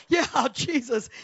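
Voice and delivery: loud, drawn-out desperate cry; mock panicked agreement. Imitating boyfriend jumping on her prayer, mock panic. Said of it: "with panic in his face".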